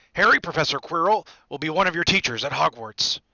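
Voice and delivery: loud, hearty introduction; Hagrid. A narrator's reading. Hagrid presenting Harry's teacher, loud and hearty. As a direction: introduce him in a big voice.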